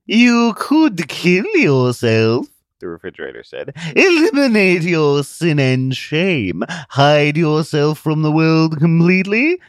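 slow, high, loud tempting voice; sinister refrigerator. Acted. Refrigerator urging Zealot to hide forever, slow sinister voice. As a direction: coax him darkly like an evil machine.

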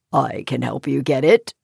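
low, friendly frog. Acted. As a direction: voice a kindly little frog. Frog speaks first.

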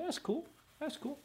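high, soft mock agreement; casual agreeing listener. Listener casually agreeing with the preacher, lightly mocked. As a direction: nod along in a small, high voice. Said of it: "Okay, you say".

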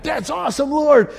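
high, excited cheer; mock elated praise. Believer high-fiving the Spirit, high excited cheer. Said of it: "You're like".

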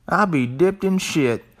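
high, slow country twang; exaggerated country twang, pitched well up. Shown as a demonstration. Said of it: "Let me say that with a country twang".

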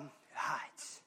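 barely voiced, secretive whisper; hushed whisper. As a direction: breathe it like something hiding in the grass. Young lion hides quietly.

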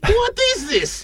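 high, squeaky worried question; mock worried question. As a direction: squeak it like a nervous buyer. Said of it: "Do not question".

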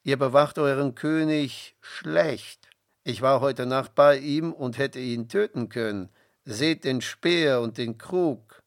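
loud call from the mountaintop, slowing at the end; shouting down the hill. A narrator's reading. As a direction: call down to the camp so all can hear. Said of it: "rief laut zu den Zelten hinab".

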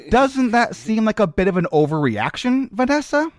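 high-pitched disbelieving friend; incredulous friend. Acted. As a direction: squeak it out in disbelief.

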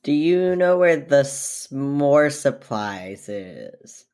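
very slow, deadpan question; slow in character. Acted. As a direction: drag out each word in character. Player speaks as [name].

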